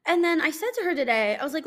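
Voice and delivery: ordinary talk, nothing marked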